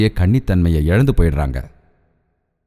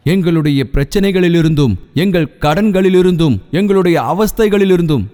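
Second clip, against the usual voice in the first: fervent prayer; 4 semitones higher than usual. Acted. Pastor praying aloud for the congregation, fervent building prayer. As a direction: plead for deliverance, building.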